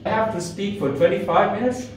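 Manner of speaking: "I have to speak for twenty-five minutes" pitched high, rising on "minutes"; emphasize "twenty-five minutes" with big upward swings; high, emphatic echo with a questioning lift; heavy emphasis. Shown as a demonstration. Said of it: "with the lot of emphasis".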